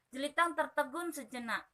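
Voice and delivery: usual speaking voice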